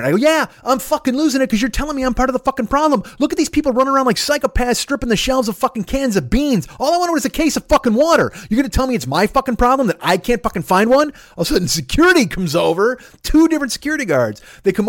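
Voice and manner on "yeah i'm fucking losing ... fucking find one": frantic high-pitched rant; retold losing it. Raging at his brother over a case of water, losing it. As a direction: rage on at full tilt, voice way up high. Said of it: "you're fucking losing it".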